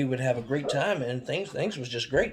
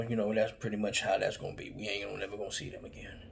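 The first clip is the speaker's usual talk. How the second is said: much softer, fast, fading flat; quiet dismissive dad; 5 semitones lower, 8 dB quieter, faster than usual. Retold or imitated. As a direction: mutter it resigned. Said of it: "he was like".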